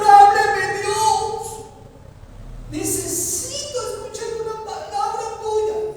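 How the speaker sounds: high, loud, tearful begging; desperate believer crying out to God; desperate plea. Pretend. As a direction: beg God to speak, almost crying.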